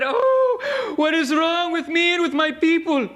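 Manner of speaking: high, loud crying wail; anguished wail. Retold or imitated. Preacher voicing old shaman as he weeps, high loud anguished wail. Grandpa cries out in story.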